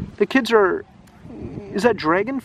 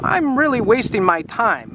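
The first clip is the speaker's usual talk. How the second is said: higher, funny storybook grumbler voice; Menzie's funny voice; 5 semitones higher than usual. Acted. Reader voicing muttering Menzie for a listener, funny voice. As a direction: play a put-upon character muttering, pitched up. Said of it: "Menzie has a funny voice".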